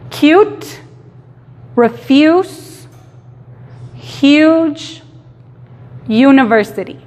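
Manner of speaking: "Cute" "refuse" "huge" "university" con yu y tono subido; repeat yu words. Shown as a demonstration. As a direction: repite marcando yu, algo más agudo. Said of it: "cuando el sonido es u". (repetición del sonido yu)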